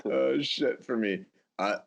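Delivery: ordinary talk, nothing marked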